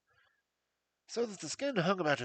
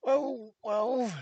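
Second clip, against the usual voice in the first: higher, louder nervous stammer; 8 semitones higher, 8 dB louder than usual. A narrator's reading. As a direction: stall anxiously, caught off guard. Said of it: "said the resident patient in a nervous fashion".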